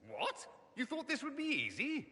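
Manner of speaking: high-pitched, teasing archdemon taunt; mock archdemon. Mage mimics an archdemon for the Inquisitor, mock archdemon. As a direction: play the archdemon's retort, pitched well up. Archdemon's side of imagined exchange.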